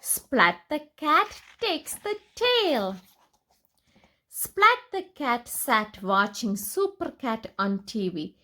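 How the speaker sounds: usual speaking voice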